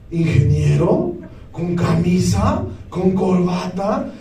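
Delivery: mock respectful murmur of a passerby; admiring passerby. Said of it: "decías". (imitando a alguien que admira, grave y lento)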